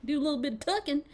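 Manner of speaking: fake Southern accent. Shown as a demonstration. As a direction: put on a playful Southern accent. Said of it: "I can fake a Southern accent".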